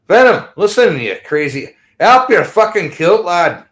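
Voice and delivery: high, loud mock Scottish jeer; mock Scottish teasing. Host teasing his Scottish co-host in a loud mock Scottish accent. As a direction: rib him in a hammed-up Scottish voice. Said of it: "crazy Scotch drinking bastard".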